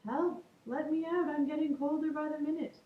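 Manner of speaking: high, pleading little voice; small voice calling out. Acted. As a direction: plead from inside the fridge. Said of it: "he called".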